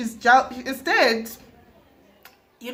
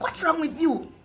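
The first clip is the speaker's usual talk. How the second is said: high-pitched demanding mum; mock scolding, pitched right up; loud mother; 6 semitones higher than usual. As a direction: imitate a mother loudly questioning her child. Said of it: "loud woman".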